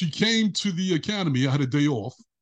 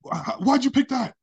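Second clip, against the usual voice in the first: high and louder, overwhelmed; tearful excitement; 9 semitones higher, 3 dB louder than usual. Retold or imitated. Girlfriend in tears at the mall, high excited gush. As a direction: gush it through happy tears. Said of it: "And she's like".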